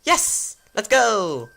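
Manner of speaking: put-on high, gleeful girlish cheer; delighted high-pitched victory cry; excited girl. Girl celebrates his agreeing.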